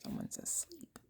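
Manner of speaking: soft whisper so nobody wakes; whispered note that someone fell asleep. Real, not acted. Aside about a sleeping listener.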